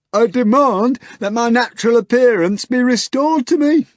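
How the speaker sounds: high loud demand in a strange voice; hoarse grunt, half furious. Acted. The hog demanding his face back, high and loud. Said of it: "the hog grunted hoarsely".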